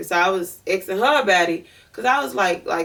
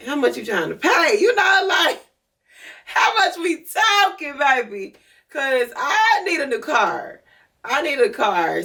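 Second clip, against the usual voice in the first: greedy hustler voice; 6 semitones higher than usual. Retold or imitated. Asking how much he pays.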